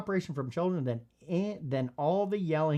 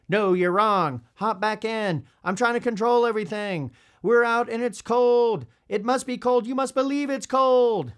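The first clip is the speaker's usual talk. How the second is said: louder, higher mock-insistent parent; controlling parent; 5 semitones higher, 5 dB louder than usual. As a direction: lay down the law like an overbearing parent. Acted controlling parent arguing.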